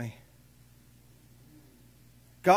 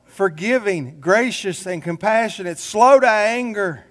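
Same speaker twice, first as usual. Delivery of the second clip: slow emphatic reading; 4 semitones higher, slower than usual. Shown as a demonstration. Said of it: "He says".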